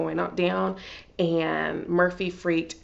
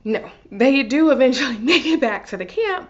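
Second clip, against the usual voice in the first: laughing; 5 semitones higher, 7 dB louder than usual. Real, not acted. Laughing while recapping the episode.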